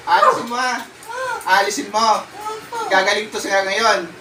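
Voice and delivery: ordinary talk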